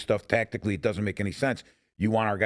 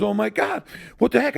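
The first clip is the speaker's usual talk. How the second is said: higher, louder startled outburst; shocked exclaim; 10 semitones higher, 4 dB louder than usual. Retold or imitated. Olinsky grabbed by the legs, loud startled outburst. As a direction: gasp it out in shock. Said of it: "He goes".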